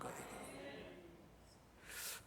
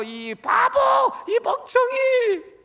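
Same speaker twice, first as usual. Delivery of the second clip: put-on high, drawn-out scolding of a child; angry parent's insult; 6 dB louder, slower than usual. Parent yelling at a child in anger, high and slow. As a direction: sneer it like a furious parent. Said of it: "부모 된 분들이 자식들에게, 화가 난다고".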